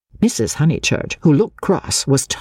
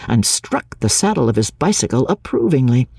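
usual speaking voice

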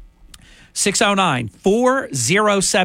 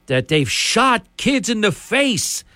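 mild mock yell; 6 semitones higher, slower than usual. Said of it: "he's screaming".

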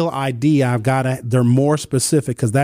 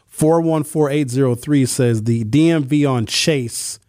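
usual speaking voice

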